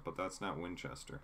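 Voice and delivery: ordinary talk, nothing marked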